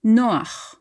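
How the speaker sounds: Noach with a throaty ch at the end; Hebrew Noach. Shown as a demonstration. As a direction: say Noach, stress on NO, rasp the ch. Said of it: "is pronounced Noach".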